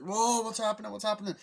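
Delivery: high, loud bewildered cry; mock alarm. Rider on a wild kart route, high mock alarm. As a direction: cry out as if swept along.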